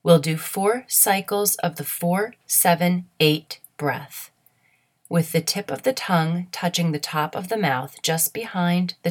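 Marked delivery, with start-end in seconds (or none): none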